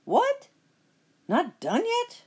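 high, loud outraged squawk; surprised witch. Acted. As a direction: shriek it up in shock. Witch finds girl not done.

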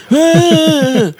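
put-on piercing high baby wail; baby cry imitation. Mom imitating the baby crying for someone. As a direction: let out a baby's fussing cry. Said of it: "and be like".

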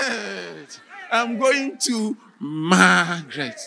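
laughing, slow, joyful shout of her name; happy shout. Acted. Prisoner finally on the list, laughing joyful shout. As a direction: let the joy burst out. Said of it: "then he shouted".

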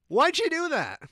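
high, loud disbelieving question; incredulous question. Retold or imitated. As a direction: demand it in disbelief. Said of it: "I'm like".

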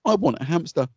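hint of a child begging dad; lightly imitated crying child. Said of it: "all he has done all afternoon is cry".

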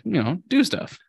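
ordinary talk

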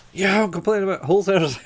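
high, fast defiant line through a laugh; mock defiance. Author admitting his gripe to the host, high and laughing. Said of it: "he just goes".

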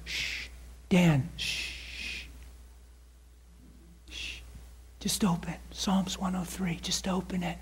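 soft hushed whisper. Retold or imitated. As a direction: hush him gently, barely voiced. Holy Spirit hushing him.

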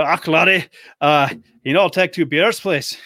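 mock Scottish brogue, not quite convincing; mock Scottish accent. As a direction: lightly do a Scottish pub order accent. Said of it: "that's a bit more Irish there".

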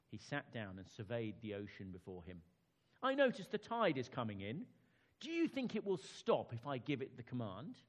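storyteller voice for a bedtime tale; children's story narration. Acted. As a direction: read it like sitting kids down for a story. Reads children's story aloud.

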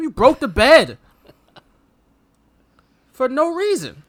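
loud, exasperated scolding; mock complaint. Samantha scolding Rip for the broken bed, exasperated. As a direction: scold him for breaking the bed.

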